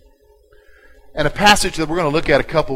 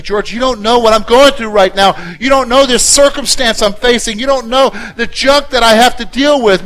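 imitating a complaining churchgoer; 6 semitones higher, 7 dB louder than usual. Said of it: "You say to me".

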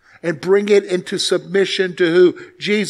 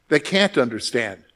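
normal talking voice

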